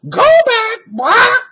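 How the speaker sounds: very high, loud parrot squawk. A narrator's reading. Parrot warns the children, high squawk. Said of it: "said Polly".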